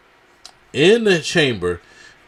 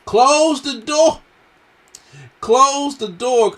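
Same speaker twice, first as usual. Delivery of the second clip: much higher, louder, slow imitated yell; McAfee screaming; 13 semitones higher, 6 dB louder, much slower than usual. Pat McAfee yelling at the ref to close the door, high and loud. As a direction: bellow it like a frantic commentator. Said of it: "Pat McAfee was screaming".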